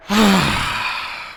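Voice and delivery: long, audible exhale; heavy sigh. Real, not acted. Gamer after a bad spell in match, heavy sigh.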